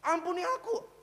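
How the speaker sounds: prayerful plea. Acted. David begging God's forgiveness in the tent, pleading. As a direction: plead upward to God. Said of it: "sujud di kemah suci dan bilang".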